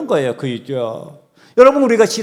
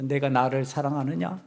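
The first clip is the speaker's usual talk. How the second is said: soft first asking; 3 semitones lower, 10 dB quieter than usual. Acted. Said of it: "세 번 질문을 합니다".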